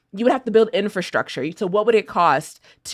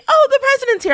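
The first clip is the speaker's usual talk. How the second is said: much higher, louder gushing announcement; mock excitement; 7 dB louder, faster than usual. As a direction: gush like a starstruck neighbour. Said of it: "someone's like".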